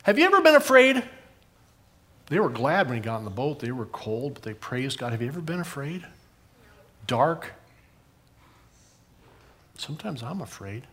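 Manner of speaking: ordinary talk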